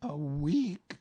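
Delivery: high bear voice, heavy and sorry; gloomy Pooh. Acted. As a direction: sigh it out. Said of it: "said Pooh gloomily".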